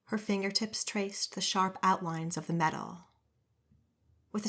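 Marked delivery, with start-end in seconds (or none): none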